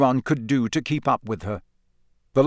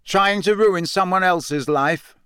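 slow, louder and higher call-out; 7 semitones higher than usual. A narrator's reading. Harry calling out across the pub at Rita, loud. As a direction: say it for the whole pub to hear. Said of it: "said Harry loudly".

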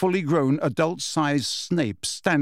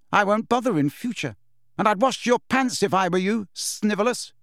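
higher-pitched cool scorn; 7 semitones higher than usual. A narrator's reading. Lily to Snape after his insult, cool scorn. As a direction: coolly brush them off. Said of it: "she said coolly".

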